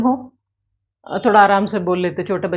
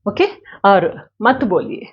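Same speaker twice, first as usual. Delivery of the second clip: ordinary talk, nothing marked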